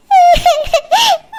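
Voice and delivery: a scornful burst of laughter; sarcastic laugh at the refusal; mocking laugh. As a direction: laugh in their face. Mocking the apology in quarrel.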